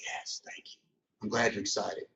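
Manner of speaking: soft, near-whispered private delight; inner glee. Retold or imitated. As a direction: hush it like thoughts inside your head. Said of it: "But inside, I'm like".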